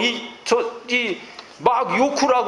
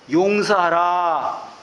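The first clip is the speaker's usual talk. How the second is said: slow solemn command; 2 semitones lower, much slower than usual. Retold or imitated. Preacher quotes Jesus' command.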